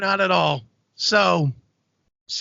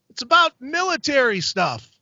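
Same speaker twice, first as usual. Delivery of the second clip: mild higher-pitched call-out; retold yell; 6 semitones higher than usual. Author answering the comedian from the audience, mild mock yell. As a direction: imitate calling a short answer to the stage. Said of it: "So I just yell".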